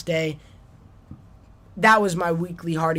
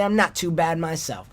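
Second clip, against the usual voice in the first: ordinary delivery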